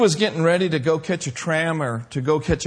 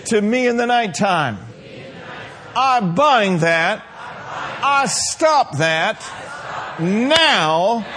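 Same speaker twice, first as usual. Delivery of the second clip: declaration prayer; 3 semitones higher, slower than usual. Acted. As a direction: lead the church through the prayer line by line. Leads congregation in declaration.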